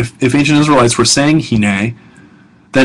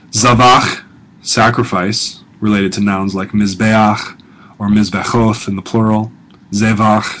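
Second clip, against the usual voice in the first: usual speaking voice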